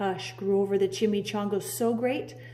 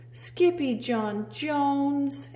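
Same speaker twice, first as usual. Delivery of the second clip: scolding cry; 6 semitones higher, much slower than usual. Acted. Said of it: "everyone cried".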